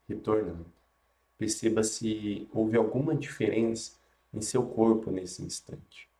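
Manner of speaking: guided meditation. Acted.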